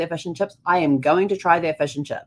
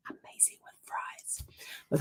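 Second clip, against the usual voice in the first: whispered aside, almost no voice; 16 dB quieter than usual. Real, not acted. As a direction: let it slip out as a secret whisper. Confiding food tip.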